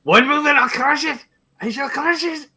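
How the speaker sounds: high barbarian threat voice; pompous villain threat. Retold or imitated. Imitating the preview villain.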